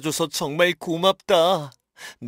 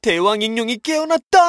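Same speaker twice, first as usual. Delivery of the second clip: loud high alarm cry; alarmed shout; 8 semitones higher, 7 dB louder than usual. Acted. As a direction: warn everyone urgently. Alarm, villain wakes.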